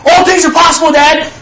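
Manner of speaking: put-on high, loud child's voice; a child sure of his point; eager little boy. Son quotes faith back to dad, high and loud. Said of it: "And he goes".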